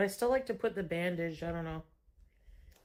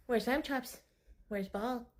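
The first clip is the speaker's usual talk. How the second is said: soft high sing-song, fading; cat talk; 4 semitones higher, 5 dB quieter than usual. Acted. Owner softly cooing food names to her cat. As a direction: coo the questions, getting softer.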